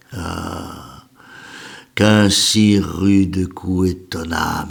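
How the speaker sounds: slow, measured elegy with pauses between lines; poem recitation. Acted. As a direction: recite a lament, swelling in grief then fading to a hush. (déclamation lente d'un poème élégiaque)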